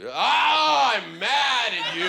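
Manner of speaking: high, loud, slow mock anger shout; mock yell. To his cheating girlfriend, a hammed-up high loud yell. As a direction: ham up a pointless yell. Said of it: "What's that gonna do?".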